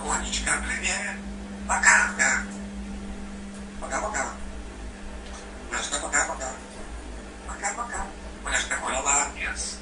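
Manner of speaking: fast soft whisper. Real, not acted. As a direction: whisper hello quickly. Whispering to the dog.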